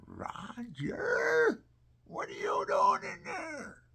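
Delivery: high, loud, drawn-out call; mother hollering out. Retold or imitated. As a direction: holler into the next room as a mother. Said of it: "His mother hollers out".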